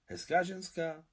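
eager, curious rising question on "Hezká ženská"; lively question. A narrator's reading. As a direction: ask "Hezká ženská" with lively interest, pitch up. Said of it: "zeptala se živě stařena".

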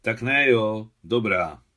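loud, grudging giving in; grumbling. A narrator's reading. Boy gives up begging for a story, grumbling. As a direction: grumble as you give up. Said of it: "brbla Miťka".

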